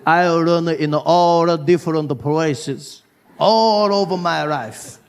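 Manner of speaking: slow English in a put-on Japanese accent. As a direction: keep slipping into a Japanese accent. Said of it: "speak English with a Japanese accent".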